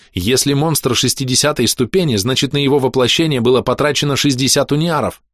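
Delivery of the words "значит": plain reading voice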